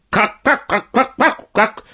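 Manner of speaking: very high flustered hen cackle; high-pitched staccato poultry chatter; clucking, chattering hens. A narrator's reading. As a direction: cluck the words like startled hens. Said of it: "затараторили куры".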